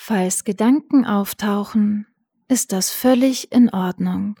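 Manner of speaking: calm guided breathing; soothing spa whisper. Acted. Guided meditation.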